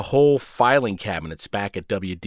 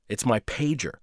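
usual speaking voice

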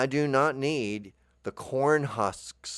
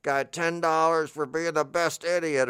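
mock sender voice; 6 semitones higher than usual. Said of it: "Or they go".

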